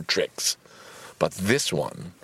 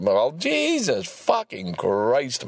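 slow exasperated curse; 4 semitones higher, much slower than usual. Real, not acted. Frustration at computer.

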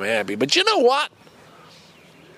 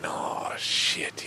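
whisper; 9 semitones lower, 9 dB quieter than usual. Retold or imitated. Inner thought, feeling jealous.

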